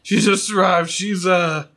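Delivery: high, loud excited cry; excited announcement. Acted. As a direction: burst in with the news, high and loud. Belvedere repeats the news.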